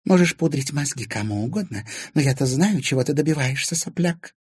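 high, contemptuous confidential jab; undertone. A narrator's reading. Stepmother confronting Timofey out of earshot, contemptuous undertone. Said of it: "женщина произнесла вполголоса".

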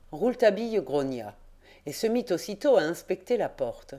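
plain reading voice

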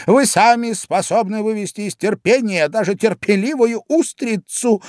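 very high insolent retort; cheeky young crab. A narrator's reading. Young crab talks back to father, insolently. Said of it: "дерзко ответил юный краб".

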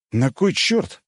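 high-pitched indignant protest; mildly outraged questioning. A narrator's reading. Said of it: "возмутился я".

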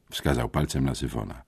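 plain reading voice

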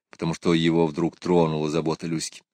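ordinary delivery, nothing marked

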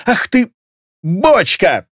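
high, indignant insult; shrill-edged angry outburst; flaring up. A narrator's reading. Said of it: "завелась Левканоя".

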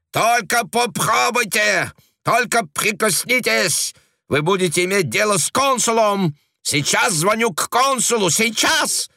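very high, furious threats; raging. A narrator's reading. Frenchwoman raging at agents, furious high threats. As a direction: scream threats in a rage.

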